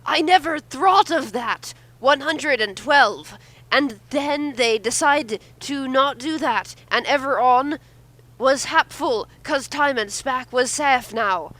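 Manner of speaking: slow, gleeful reading of the villain's line; a hint of a gloating kid villain; lightly voiced villain. Reading the Master's triumphant line to the group, slow and gleeful.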